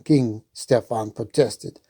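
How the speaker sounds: ordinary delivery, nothing marked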